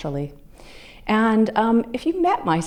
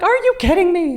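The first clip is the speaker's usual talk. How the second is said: higher, louder, delighted outcry; thrilled disbelief; 13 semitones higher, 6 dB louder than usual. Retold or imitated. Best friend crying out high and loud in disbelief. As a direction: friend overjoyed at the pregnancy news. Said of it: "She's like".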